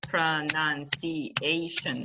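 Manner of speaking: slow, evenly clapped-out syllables; pro-nun-ci-a-tion, each beat separate. Shown as a demonstration. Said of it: "clap it".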